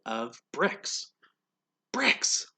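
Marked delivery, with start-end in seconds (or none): 1.9-2.5 high delighted cry of discovery; excited pig. Acted. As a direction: burst out with joy at the find. Pig delighted to find bricks.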